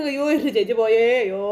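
theatrical wail leaning on എൻ്റെ; fake crying. Uncle repeating the fake wail for sister. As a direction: keep up the staged lament. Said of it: "ഭയങ്കര കരച്ചിൽ".

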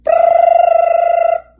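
very high, loud rolled brrr; shrill ringing trill; alarm ring. Acted. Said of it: "went the alarm clock".

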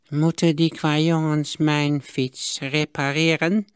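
put-on slow, scornful old man's voice; contemptuous miser. A narrator's reading. Miser scorns the boys to the smith, slow sneer. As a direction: look down on the boys, drawn out slow. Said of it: "minachtend aan".

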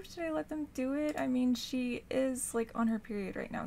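usual speaking voice

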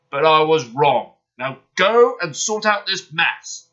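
loud, stern command; scold, then order them off firmly; severe. A narrator's reading. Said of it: "he spoke severely".

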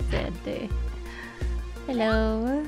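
ordinary talk, nothing marked

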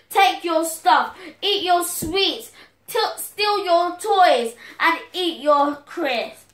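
high, loud mock angry yelling, slowing down; pretend shout. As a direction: yell it like an angry child chasing a friend. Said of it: "shouting at them".

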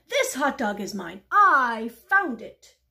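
loud claim, stretching "I"; possessive insistence. Acted. Pigeon defending its find from the duck, insistent. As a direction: insist loudly, hammering "I".

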